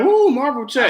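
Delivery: high, loud mock cheer for Marvel; excited cheer. Friend imitating an excited fan cheering, high and loud. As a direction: squeal it like a hyped fan. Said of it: "She's like".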